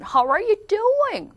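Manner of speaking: high, over-the-top American "How are you doing?"; exaggerated American accent. Shown as a demonstration. Teacher mocking Americans making a fuss, exaggerated American accent. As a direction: stretch it high and slow like a gushing American. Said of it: "뭐 난리 났죠".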